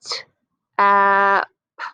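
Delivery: slow blending sounds; slow sliding sounds. Shown as a demonstration. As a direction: slide through each sound, held long. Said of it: "slide to the sounds".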